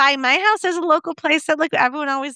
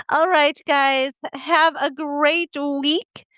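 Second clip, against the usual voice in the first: slow, stretched friendly farewell; podcast sign-off; 5 semitones higher, much slower than usual. Acted. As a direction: sign off the show warmly and slowly.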